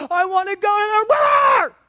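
put-on loud, high shouted toddler wail; toddler's crying wail. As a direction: wail it like a toddler denied the river. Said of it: "he's crying".

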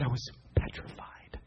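hushed, breathy whisper of fear. Retold or imitated. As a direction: whisper it like a scared boy's secret. Fearful confession retold.

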